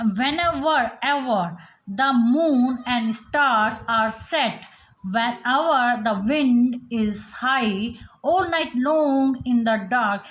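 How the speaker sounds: slow, measured recital marking the stressed beats; slow sing-song poem recitation; rhythmic poem reading. Shown as a demonstration. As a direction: read each line slowly, leaning on the beats. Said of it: "stress दिया जाता है वो क्या दिया है उन्होंने".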